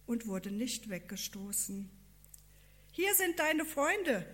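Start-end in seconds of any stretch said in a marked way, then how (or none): 2.9-4.2 higher-pitched, lively announcement; announcing. A narrator's reading. Farmer presents the visitors to his friend, announcing. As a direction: announce the guests with eagerness. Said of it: "verkündete der Bauer".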